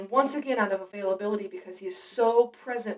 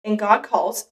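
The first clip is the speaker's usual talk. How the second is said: normal talking voice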